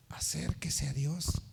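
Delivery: slow soft whisper; a whispered plea to draw near to God; whispered advice. Retold or imitated. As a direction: breathe the counsel gently, slow. Retold gentle advice to pray.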